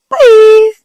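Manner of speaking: high, loud sing-song goodbye; chirpy bye. Retold or imitated. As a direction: toss off a bright high bye. Leaving after uploading the recording.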